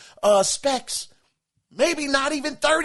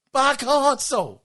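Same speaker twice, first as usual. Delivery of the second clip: ordinary talk, nothing marked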